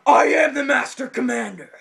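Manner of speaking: loud, high victory shout; mock-heroic battle cry; triumphant proclamation. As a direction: bellow it like a self-crowned conqueror. Triumphant declaration, fists in air.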